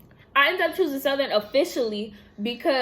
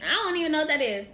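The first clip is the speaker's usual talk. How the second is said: higher, incredulous "what?" and a fast scoff; mock disbelief; 6 semitones higher than usual. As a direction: play dumb, shocked by the question. Scoffing at the question "first love.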